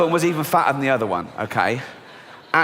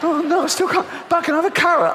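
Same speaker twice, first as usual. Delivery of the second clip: high, frantic and starving; much higher, panicking over a banana; desperate starving shopper; 11 semitones higher than usual. Retold or imitated. Starving shopper hunting for food, high frantic whine. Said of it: "they go back".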